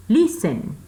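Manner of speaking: Listen, LIS high and strong, SEN falling; stress on first syllable. Shown as a demonstration. Teacher confirming the stressed syllable for students, first-syllable stress. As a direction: punch the opening syllable of Listen. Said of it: "la sílaba acentuada está al inicio".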